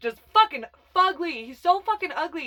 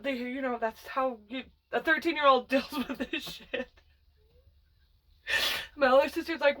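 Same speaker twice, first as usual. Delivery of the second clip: ordinary delivery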